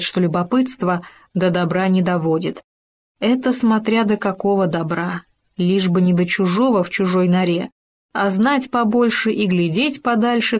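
plain reading voice

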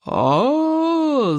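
long high "aaah" yell; drawn-out acted bellow. A narrator's reading. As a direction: hold a high open scream without full volume. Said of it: "заорал Стоик Обширный".

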